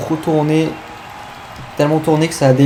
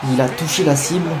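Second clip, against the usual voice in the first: normal talking voice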